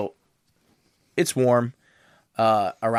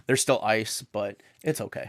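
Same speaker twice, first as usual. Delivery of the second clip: usual speaking voice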